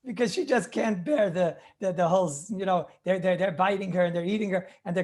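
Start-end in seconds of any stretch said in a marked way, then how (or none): none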